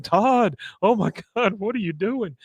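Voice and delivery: high-pitched horrified plea; Hank aghast. Retold or imitated. Hank pleads with Todd on stage, high and horrified. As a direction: raise the voice in shocked disbelief. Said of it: "He's like".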